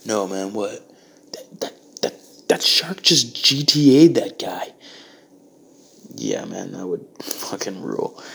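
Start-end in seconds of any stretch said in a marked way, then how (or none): 1.3-4.7 slow, stammering whisper of disbelief; awed whisper. Acted. Onlooker whispering to his friend what the shark did, slow stunned whisper. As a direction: whisper it out slowly, still in shock. Bystander describing shark attack.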